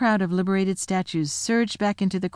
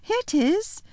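Lucy's high voice; 12 semitones higher than usual. Acted. Lucy hands hanky.

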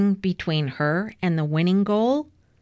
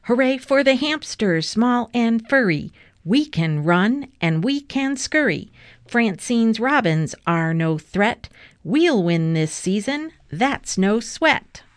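bouncy rhythmic chant, a little raised; team cheer; 4 semitones higher, 3 dB louder than usual. A narrator's reading. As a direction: chant proudly for your team. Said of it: "Fern did a cheer for her team".

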